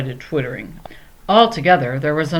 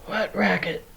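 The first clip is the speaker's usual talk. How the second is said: demanding, disguised; 4 semitones higher, 6 dB quieter than usual. A narrator's reading. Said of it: "Leo demanded".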